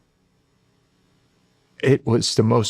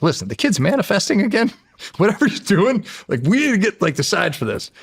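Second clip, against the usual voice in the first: excited, laughing; 10 semitones higher than usual. Retold or imitated. Calling the agent, excited and laughing about his manifesting kid. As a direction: burst out laughing with delight.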